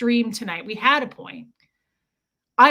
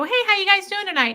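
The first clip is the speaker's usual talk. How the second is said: high, fast chirpy server greeting; imitating an upbeat waitress stopping by the table; perky waitress; 11 semitones higher, 5 dB louder, faster than usual.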